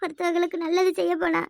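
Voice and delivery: tearful, put-on apology; crying. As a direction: plead with the husband through sobs. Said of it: "இப்ப நீ வேற ஏமா அழுற?". (அழுதபடி மன்னிப்பு கேட்கும் குரல்)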